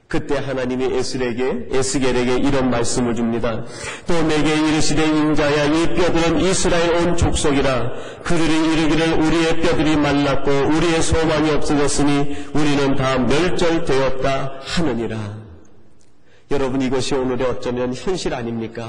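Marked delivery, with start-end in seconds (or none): 4.1-15.4 loud, ringing scripture reading; preaching scripture. Acted. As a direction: proclaim the verse to the whole hall, slowing at the end. Reads scripture aloud in sermon.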